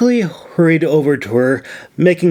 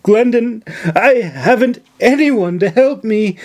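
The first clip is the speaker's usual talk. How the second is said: higher, gasping put-on grief; breathless fake distress; 8 semitones higher than usual. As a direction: work up the fake tears. Nora fakes distress to governor.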